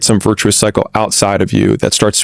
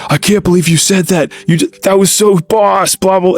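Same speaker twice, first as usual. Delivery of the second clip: pitched way up, gushing with laughter in it; mock amazed friend; 9 semitones higher than usual. Friend retelling the encounter, high and giddy. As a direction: replay the friend cracking up in disbelief. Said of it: "just starts crying, laughing".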